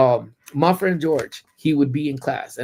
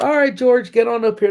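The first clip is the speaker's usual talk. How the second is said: a loud, higher-pitched teacher calling up a student; 9 semitones higher, 6 dB louder than usual. Retold or imitated. Teacher calling George to the front to recite, loud and high. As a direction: summon him to the front of the room.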